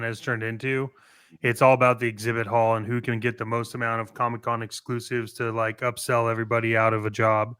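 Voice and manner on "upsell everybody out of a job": ordinary talk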